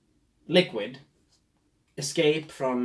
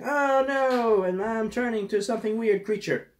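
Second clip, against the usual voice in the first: panicked crocodile; 7 semitones higher than usual. Retold or imitated.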